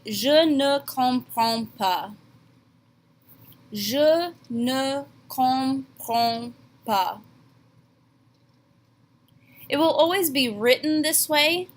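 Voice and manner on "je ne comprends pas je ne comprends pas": Je ne comprends pas, slow, nasal on comprends; teacher modelling for students; slow French demo. Shown as a demonstration. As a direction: model the phrase slowly. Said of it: "you're going to tell me".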